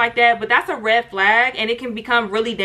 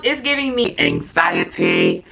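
mock anxious inner voice; slower than usual. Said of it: "I'm like".

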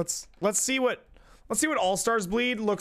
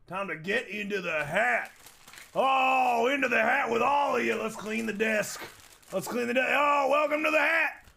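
hammed-up hero; 3 semitones higher than usual. Playful character voice cleaning desk.

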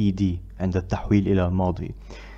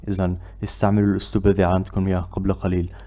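ordinary delivery

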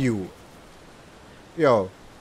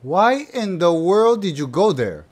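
usual speaking voice

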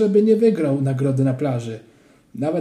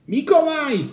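put-on high child's yell; shout; 12 semitones higher, 4 dB louder than usual. A narrator's reading. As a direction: yell the name out. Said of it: "krzyknął Kleofas". (głośny okrzyk)

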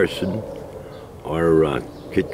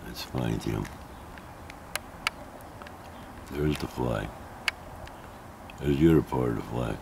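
low, soft murmur to himself; hushed, near whisper; 5 semitones lower, 11 dB quieter than usual. Real, not acted. Vlogger hunting for the fly with his camera, hushed. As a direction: keep it down, as if not to disturb it. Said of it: "I don't know why I'm whispering".

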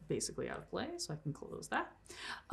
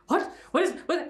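high, stammered double take; startled ghost; 8 semitones higher, 3 dB louder than usual. Acted. Game master voices a ghost shoved by stars, startled stammer. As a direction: stumble over the words in mock alarm. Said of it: "ghost's eyes get large".

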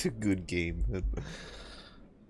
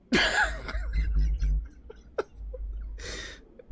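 coughing from laughter. Real, not acted. Laughing fit turns to coughing.

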